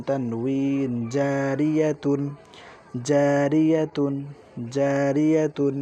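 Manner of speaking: slow teacher's drill. Shown as a demonstration. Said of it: "slow drill for students".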